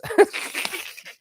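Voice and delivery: high breathless laugh burst; a sudden airy laugh at the insult. Real, not acted. Laughing at a viewer calling them whiny.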